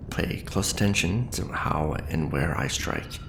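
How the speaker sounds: ordinary delivery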